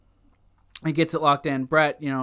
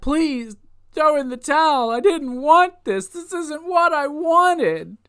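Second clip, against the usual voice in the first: high, pleading imitation of crying; put-on desperate begging, drawn out at the end; mock tearful begging; 9 semitones higher, 3 dB louder than usual. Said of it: "Owen starts crying, begging".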